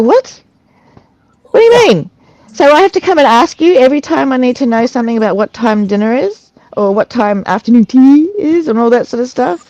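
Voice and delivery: high-pitched, indignant disbelief; incredulous challenge. Retold or imitated. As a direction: retell it as an outraged questioning of staff. Retold indignant challenge to staff.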